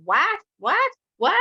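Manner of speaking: rising, loud, incredulous "what"; stunned disbelief. Retold or imitated. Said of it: "and you would just go".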